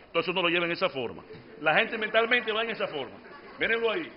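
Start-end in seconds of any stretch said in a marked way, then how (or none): none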